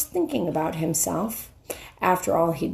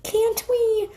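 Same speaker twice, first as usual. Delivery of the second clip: put-on much higher, anxious pleading girl voice; 12 semitones higher than usual. Susan pleading into the lion's ear. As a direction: beg him urgently. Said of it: "whispered Susan in the lion's ear".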